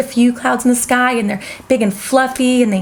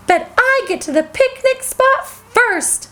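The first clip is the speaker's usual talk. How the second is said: teasing; 8 semitones higher than usual. Acted. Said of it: "she teased Minnie".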